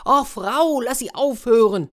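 high, pleading storybook cry. A narrator's reading. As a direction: call out for the beating to stop. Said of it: "da rief er schließlich".

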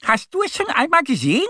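high, rapid duck call; rufende kleine Ente. A narrator's reading. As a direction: fire the question after them, high and fast. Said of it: "ruft Annie der Gemse hinterher". (hoch und schnell hinterhergerufen)

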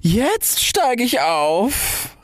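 very slow, high, stretched announcement; triumphant cry. A narrator's reading. Rocket starting to burn, high drawn-out cry. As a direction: draw each word out high, like a launch. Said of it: "rief die Rakete".

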